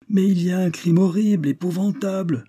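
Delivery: high, urgent announcement; alarmed cry. A narrator's reading. Callers reporting a crime to the boss, alarmed cry. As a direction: cry out the horror at a high pitch.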